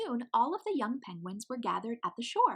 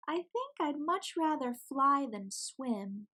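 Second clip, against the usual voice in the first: wistful little penguin; 6 semitones higher, slower than usual. Acted. Pip wishes she could fly.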